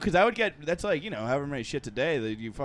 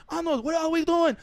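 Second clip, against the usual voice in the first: high put-on cartoon voice; imitating Mister Wynn; 13 semitones higher than usual. Friend quoting the Hey Arnold teacher in a high put-on voice. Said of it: "he's like".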